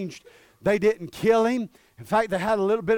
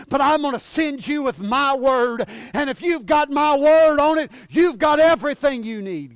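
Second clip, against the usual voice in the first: loud, raised, driving sermon voice; full-force preaching; 6 semitones higher, 6 dB louder than usual. Real, not acted. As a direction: build to a ringing pulpit cry.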